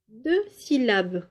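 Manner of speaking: ordinary delivery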